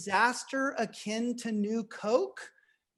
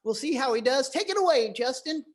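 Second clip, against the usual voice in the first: ordinary talk, nothing marked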